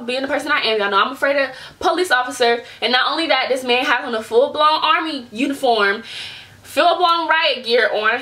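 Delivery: normal talking voice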